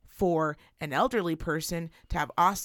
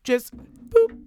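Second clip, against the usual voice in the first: high, loud mock helper voice; a high sing-song helper showing how it works; hammed-up patient clerk explaining; mock sweet helper; 7 semitones higher, 4 dB louder than usual.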